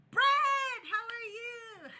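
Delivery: high, loud, drawn-out hello; real sing-out welcome, pitch way up; excited greeting. Real, not acted. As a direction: call out a name in delight as a friend arrives. Streamer calls greeting to arriving viewer.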